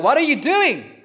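sudden high, loud cry of protest; a shocked, loud "what are you doing" at the woman; indignant outburst. Retold or imitated. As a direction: blurt it out like an outraged disciple. Voicing upset disciples.

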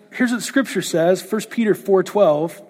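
ordinary talk

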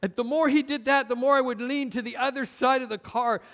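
usual speaking voice

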